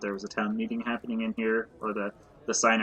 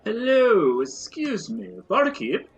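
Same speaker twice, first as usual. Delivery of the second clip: high, eager polite greeting; polite adventurer; 8 semitones higher, 4 dB louder than usual. Acted. Player hails the barkeep as adventurer, eager and polite. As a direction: hail the barkeep brightly. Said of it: "player addressing the barkeep in character".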